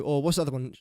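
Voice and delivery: ordinary talk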